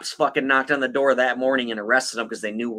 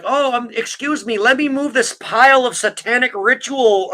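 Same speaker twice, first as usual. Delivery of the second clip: higher, louder mock-casual apology; mock politeness; 8 semitones higher, 4 dB louder than usual. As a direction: act out an absurdly polite excuse. Acts out absurdly staged excuse to visitor.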